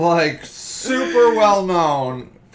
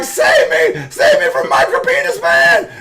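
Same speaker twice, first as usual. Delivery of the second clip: much higher, loud, laughing shriek for help; mock damsel scream; 5 dB louder than usual. Woman in the picture begging rescue, laughing mock scream. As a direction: scream like a hammed-up damsel in distress. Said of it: "She's like".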